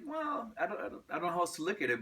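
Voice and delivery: high-pitched, hurried mock protest; acted defensive reply. Performer answering doubters about his nostalgia, high mock defensiveness. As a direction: pitch it way up, imitating your own flustered answer. Acting out own answer to them.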